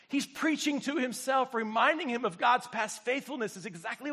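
loud, high, driving preacher voice; preaching at full force. Acted. Pastor rallies the church to rejoice while waiting, preaching loudly. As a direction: preach it hard to the room.

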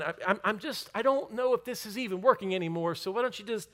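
worked-up, high, loud giving up; angry, self-pitying. Acted. Elijah telling God he is done, full of anger and self-pity. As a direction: build to an exasperated, stammering protest. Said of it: "He was full of anger".

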